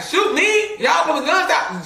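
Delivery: imitating cousin mocking the gunmen; incredulous dare. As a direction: scoff as if the threat is absurd. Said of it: "She like".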